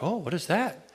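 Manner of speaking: hushed, curious little query; softly voiced sheep perking up. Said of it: "heads start perking, you know, like".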